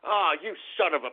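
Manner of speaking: normal talking voice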